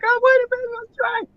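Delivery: scramble, panicking under pressure; flustered panic. Retold or imitated. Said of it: "And I'm like".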